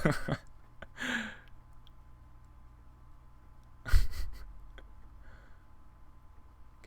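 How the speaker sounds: airy breathless high laugh; high-pitched giggle. Real, not acted. Opponent plays slowly.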